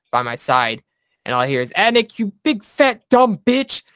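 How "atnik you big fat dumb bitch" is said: high, loud, slow furious yell; yelling drill instructor. Retold or imitated. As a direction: scream the insult like a drill instructor.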